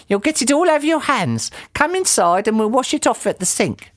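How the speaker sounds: high-pitched, bossy character voice; urgent cry. A narrator's reading. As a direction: order him about in a high voice. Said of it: "cried Missus Twit".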